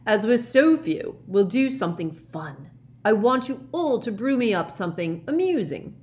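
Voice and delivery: loud, high, jolly professor; rally the small class with glee; booming good cheer; cheery Slughorn. A narrator's reading. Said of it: "said Slughorn cheerily".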